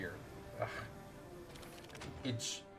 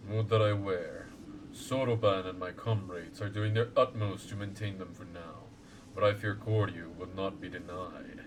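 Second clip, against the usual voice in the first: lower, solemn elder voice; wise old lord; 4 semitones lower than usual. Acted. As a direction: speak as a grave ancient guardian. Voicing Genbu lord.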